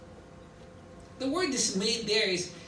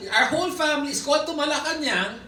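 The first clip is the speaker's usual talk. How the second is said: usual speaking voice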